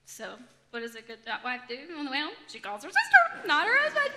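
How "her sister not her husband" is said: high, loud punchline; comic wail. Retold or imitated. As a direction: squeal the callback for laughs. Comic callback, high mock wail.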